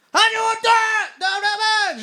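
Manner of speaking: piercingly high, loud screech; mock yelling. Counter lady yells the order to cooks, mock screech. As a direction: yell it out in a hammed-up shriek. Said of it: "She doesn't need to yell my order".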